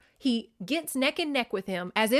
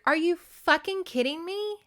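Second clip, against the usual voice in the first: incredulous outburst; 8 semitones higher than usual. Retold or imitated. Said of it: "Tharp's like".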